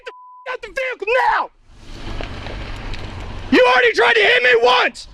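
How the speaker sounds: yelling orders at full force; shouting commands. Real, not acted. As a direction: bark commands, voice straining. Guard orders driver, gun drawn.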